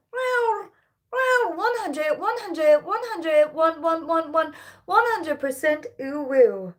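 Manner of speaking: high, slow cackling mad scientist; gleeful wobbly wow over the hundred. Pretend. Hits hundred percent.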